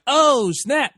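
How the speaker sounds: a sudden high, loud "oh snap"; hyped yelp of approval; excited. Retold or imitated. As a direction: burst out in delight at the news. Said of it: "So I'm like".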